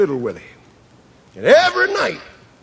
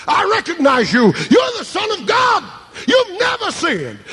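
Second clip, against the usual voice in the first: fervent cry; 12 semitones higher than usual. A narrator's reading. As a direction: lift it up as a desperate confession. Woman voiced, pleading with Jesus.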